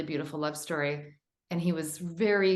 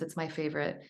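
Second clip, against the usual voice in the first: ordinary talk, nothing marked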